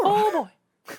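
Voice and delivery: very high, loud squeak; hammed-up Mickey; squeaky mock Mickey; Mickey Mouse voice. Kingdom Hearts voices bit.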